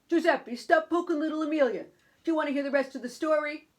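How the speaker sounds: exasperated uncle voice; scolding uncle. Acted. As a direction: rein in the children sharply.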